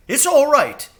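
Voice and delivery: high, gentle reassuring bird voice; kind crow. A narrator's reading. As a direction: soothe her kindly. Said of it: "he said kindly".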